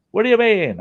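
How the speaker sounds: retold surprise. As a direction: ask it like you're caught off guard. His own surprised reply.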